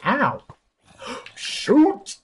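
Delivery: high gasp then yelp; startled yelp. Real, not acted. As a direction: cry out as you get hit. Getting hit while fleeing monsters.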